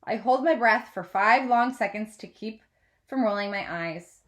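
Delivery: ordinary delivery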